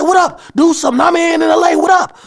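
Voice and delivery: very high, loud taunting yell; imitated shouting. Imitating the rapper yelling at dudes in L A, shouting. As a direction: yell out a challenge to a whole crowd. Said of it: "he's shouting at the dudes".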